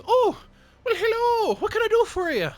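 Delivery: very high, loud sing-song greeting; cheery shopkeeper. Acted. Dwarf shopkeeper greets the party in a high voice. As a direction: greet the customer bright and squeaky. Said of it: "she looks at you and just goes".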